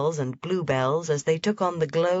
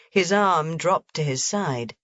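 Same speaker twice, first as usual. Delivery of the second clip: ordinary delivery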